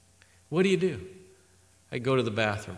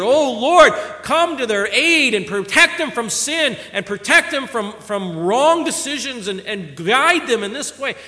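fervent prayer; 8 semitones higher, 7 dB louder than usual. Retold or imitated. Retold prayer for his family.

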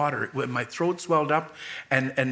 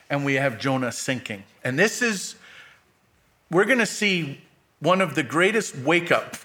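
normal talking voice

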